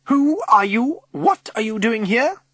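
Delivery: high, loud, imperious challenge; barking. A narrator's reading. Chair of governors confronting strangers in the hall, barking. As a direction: bark it like an outraged battle-axe. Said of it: "she barks".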